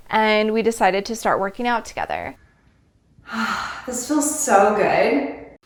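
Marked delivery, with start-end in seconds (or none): 3.3-5.6 slow, relieved and content; relieved sigh. Retold or imitated. Retelling working out with new friends, slow and relieved. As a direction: savour each word, slowly.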